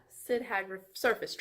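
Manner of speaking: ordinary talk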